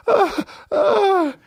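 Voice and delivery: squeaky put-on laugh-cry; mock laughing sobs up high. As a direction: gasp it out like weeping with laughter. Mimics laughing-crying release.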